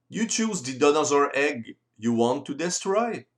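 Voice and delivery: usual speaking voice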